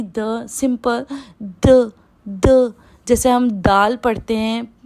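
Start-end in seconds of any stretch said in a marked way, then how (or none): 0.0-2.7 द said simply with no air; unaspirated द, slow and clear; plain de. Shown as a demonstration. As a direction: model द without any breath. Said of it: "द में कोई air नहीं निकलेगी".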